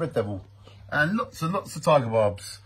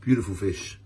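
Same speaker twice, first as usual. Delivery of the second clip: deep mock-epic fish presentation; mock dramatic announcer; 5 semitones lower, 5 dB quieter than usual. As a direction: present the fish like a grand movie monster. Resumes mock Jaws presentation.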